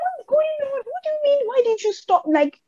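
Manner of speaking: squeaky, very high disbelief; mock shocked pitch way up; incredulous. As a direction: play it baffled and half laughing. Her stunned reply in traffic.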